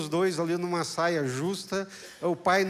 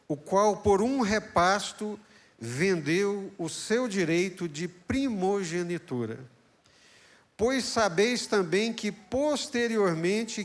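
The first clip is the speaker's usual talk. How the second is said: preached scripture reading; 5 semitones higher, 4 dB louder than usual. Acted. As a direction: read the verse like a pulpit proclamation. (leitura bíblica pregada, lenta e forte)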